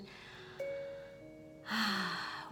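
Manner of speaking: long, soft exhaled sigh. Real, not acted. Guide talking of clearing ourselves, long releasing sigh. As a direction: let the breath go slowly and audibly.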